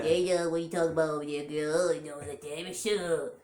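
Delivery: congested cat character voice; post-nasal drip cat. Acted. Voicing mom's cat with post-nasal drip, congested character voice. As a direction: talk like a stuffed-up cat with attitude. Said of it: "he talked like this".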